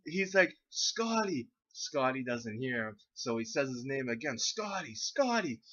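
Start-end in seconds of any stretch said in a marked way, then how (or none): 0.7-1.5 high sing-out of a name; calling out. Retold or imitated. Friend with the stick luring Scotty, calling out. 4.4-5.6 high sing-out of a name; calling out. Retold or imitated. Friend with the stick luring Scotty, calling out.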